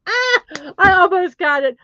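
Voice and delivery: high, loud outburst; excited frustration. Real, not acted. Streamer just missing the tongue twister, excited outcry. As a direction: cry out at a near miss. Exclaims after nearly managing the twister.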